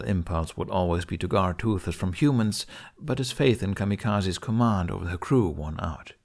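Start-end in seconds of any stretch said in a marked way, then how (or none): none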